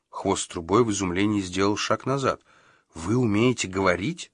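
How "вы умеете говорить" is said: ordinary delivery, nothing marked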